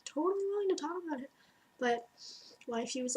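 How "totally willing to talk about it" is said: "totally willing" jumps high and runs fast; eager mock agreement. To the host mother, the eager yes comes out high and fast. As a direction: gush it out, high and quick, mock-keen. Repeats phrase, second much higher.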